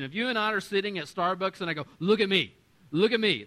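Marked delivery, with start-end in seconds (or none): 2.0-3.5 loud, pushy repeat; awkward loud stare-down; insistent demand. Shown as a demonstration. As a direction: demand it across a coffee table. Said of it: "which would be really weird".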